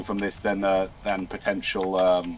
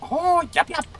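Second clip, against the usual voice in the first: squeaky yep. Pretend. Sudden squeaky reaction.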